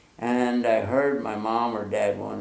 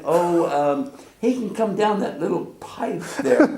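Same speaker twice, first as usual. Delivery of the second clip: laughing mother; 5 semitones higher than usual. Retold or imitated. Mother's answer voiced, laughing.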